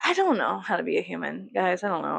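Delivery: usual speaking voice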